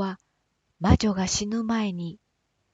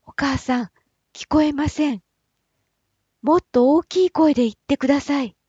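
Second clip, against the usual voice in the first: raises his voice, higher and louder, a bit faster; calls out so his weak mother can hear; calling louder; 5 semitones higher, 5 dB louder, faster than usual. Acted. Giant calling out to his dying mother, louder and higher.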